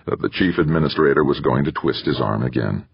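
ordinary delivery

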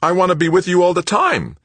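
high, loud, rushed plea; desperate insistence. A narrator's reading. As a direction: demand it like a needy child. Son begs to stay with mother.

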